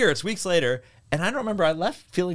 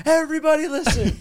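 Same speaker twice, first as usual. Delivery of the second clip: high-pitched call to the whole room; rallying shout; 12 semitones higher than usual. Retold or imitated. Woman rousing hotel guests in the breakfast area, rallying shout. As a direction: shout it out like starting an uprising.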